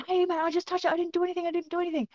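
high-pitched flustered pleading; panicked protest. Retold or imitated. Man grabbed by security, protesting in mock panic.

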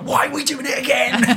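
very high, loud whining moan; squeaky high mock moan at another retake; whiny complaint. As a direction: whinge from the back like a worn-out dancer. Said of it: "I'd be in the background like".

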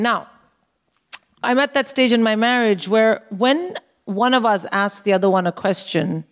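ordinary talk

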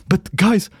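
high-pitched, drawn-out mock protest; exasperated appeal. Retelling an appeal to friends who ignore the news, exasperated. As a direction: plead for sense, pitched high.

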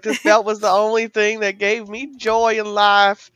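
whiny mock lament. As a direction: wail it like a melodramatic baby. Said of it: "He's like".

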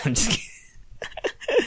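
breathy high laugh through words, slow. Real, not acted. Host laughing off his fake boast. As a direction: giggle the take-back.

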